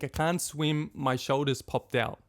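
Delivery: ordinary delivery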